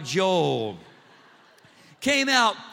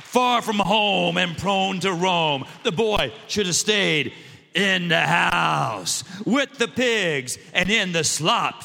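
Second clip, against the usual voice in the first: louder, slow, a preacher's shout; T D Jakes preaching; 3 dB louder, slower than usual. Retold or imitated. Pastor mimicking Bishop Jakes building to a slow, louder shout. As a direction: whoop it out slow like a bishop in full cry. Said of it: "when the bishop does that sermon, it's kinda like".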